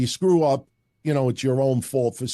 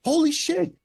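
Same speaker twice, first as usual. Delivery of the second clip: mock excited fan greeting; 13 semitones higher than usual. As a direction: fake friendly gush, pitched way up. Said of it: "He's like".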